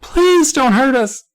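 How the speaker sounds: very high, loud, drawn-out plea; frightened wail. A narrator's reading. Small boy begging the old man, slow wail. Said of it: "Nate wailed".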